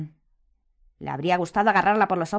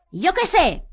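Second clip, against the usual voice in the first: high, loud exasperated bellow; 12 semitones higher, 8 dB louder, slower than usual. A narrator's reading. Harry snaps at Hermione's question, bellowing. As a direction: bellow it back. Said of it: "bramó él".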